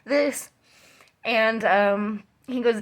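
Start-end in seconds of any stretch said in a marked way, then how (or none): 0.0-0.5 higher, mildly panicked retelling; a touch of distress in the voice; retold freaking out. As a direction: recount your alarm, pitch held up. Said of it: "I'm, like, freaking out".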